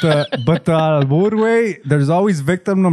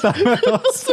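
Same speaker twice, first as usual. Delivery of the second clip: put-on much higher, laughing kid voice; mock eager kid. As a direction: squeak it out like an eager little kid. Mocking Ramón ordering tacos.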